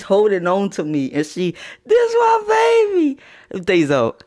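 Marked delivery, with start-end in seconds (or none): 1.8-3.2 high, loud, sobbing cry; crying shout. Retold or imitated. Mom crying on his shoulder.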